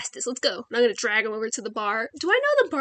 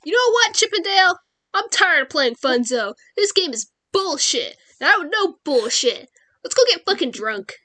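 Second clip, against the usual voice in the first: loud, higher-pitched in-character griping; fed-up saloon drinker; 5 semitones higher, 5 dB louder than usual. Acted. Player as Fernanda bangs the table, loudly fed up.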